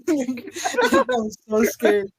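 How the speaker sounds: laughing through the words, slow; cracking up while admitting the fear; breaking into laughter mid-line. Real, not acted. Laughing while retelling his fright.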